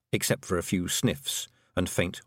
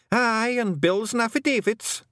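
high, slow Scottish wee man, worried; Feagle voice; 13 semitones higher, 3 dB louder than usual. A narrator's reading. A Feagle warning Tiffany about documents, high Scottish fret. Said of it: "said another".

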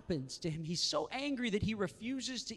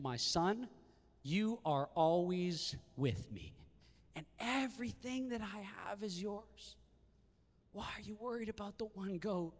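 tender father; 3 semitones higher, 4 dB quieter than usual. Acted. Father answers son with love.

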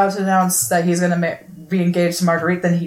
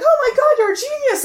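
excited squeal; 3 dB louder than usual. Retold or imitated. They cheer da Vinci opening door.

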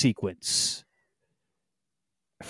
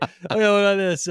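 put-on Cajun accent; 6 semitones higher than usual. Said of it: "that's the first one that was different".

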